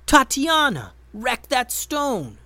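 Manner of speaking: high, loud shout to an ally; yelled call. A narrator's reading. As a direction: yell across the cave to Tatiana. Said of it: "Ben Ben yelled".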